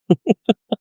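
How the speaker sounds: high burst of chuckling; real laugh. Real, not acted. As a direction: break into amused laughter. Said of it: "Sorry, I'm laughing".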